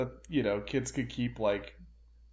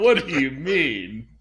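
slow, laughing silky villain; 6 dB louder than usual. Pretend. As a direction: feign innocence as a villain. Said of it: "This is just his voice now".

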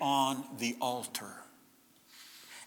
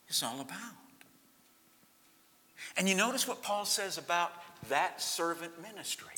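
ordinary delivery

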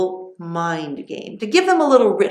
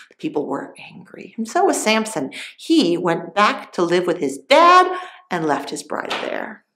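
plain reading voice

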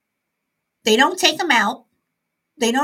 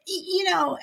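normal talking voice

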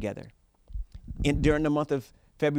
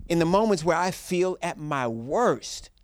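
preaching; 7 semitones higher, 5 dB louder, slower than usual. Acted. Preaching climax.

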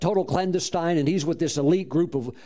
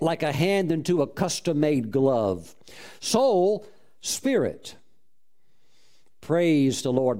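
ordinary talk

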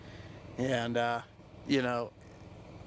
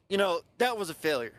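usual speaking voice

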